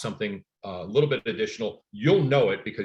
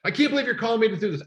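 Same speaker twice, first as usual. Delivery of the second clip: imitating an irate caller, much higher and fast; angry caller; 12 semitones higher, 4 dB louder, faster than usual. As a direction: complain like the annoyed homeowner. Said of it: "he got pissed".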